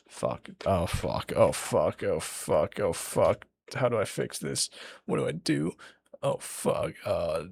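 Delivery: soft, half-whispered fretting to yourself; flustered muttering. Real, not acted. Storyteller trying to fix a mistake, hushed flustered muttering. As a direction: curse repeatedly under your breath.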